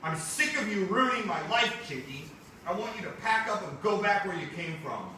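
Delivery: a hint of an angry teenager; annoyed teen. Danny telling his cousin to leave, mildly angry.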